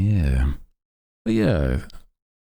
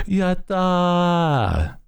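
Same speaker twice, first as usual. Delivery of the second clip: much higher, drawn-out yatta; cute anime streamer. Pretend. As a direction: squeal it like an anime girl. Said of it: "I do like using a lot of anime slang".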